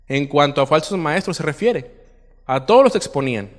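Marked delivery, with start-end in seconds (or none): none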